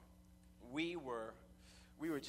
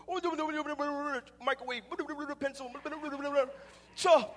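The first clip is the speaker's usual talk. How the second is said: loud, higher, garbled Jamaican patois gibberish; mock patois; 11 semitones higher, 8 dB louder than usual. Stepson mimicking his Jamaican stepfather's unintelligible talk, loud mock patois. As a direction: rattle off a mumbled Jamaican patois with words popping out. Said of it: "this is my best understanding of what he says".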